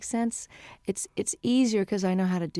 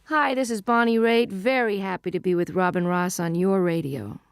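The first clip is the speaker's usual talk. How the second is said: station ident; 6 dB louder than usual. Acted. Singer greeting the host's radio listeners, station ident. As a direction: announce it brightly to the radio audience.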